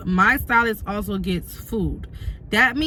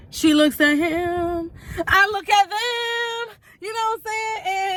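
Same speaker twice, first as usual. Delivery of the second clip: high, slow incredulous build-up; 10 semitones higher, slower than usual. Real, not acted. Awkward stare-down told slowly.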